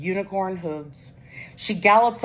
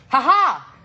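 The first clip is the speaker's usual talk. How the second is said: high, louder proud yell; 8 semitones higher, 5 dB louder than usual. A narrator's reading. Kitty shouts to her friends, proud and loud. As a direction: shout it out triumphantly. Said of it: "yells Kitty".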